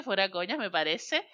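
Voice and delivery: ordinary talk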